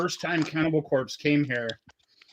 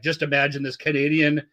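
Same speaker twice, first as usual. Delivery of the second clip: ordinary talk, nothing marked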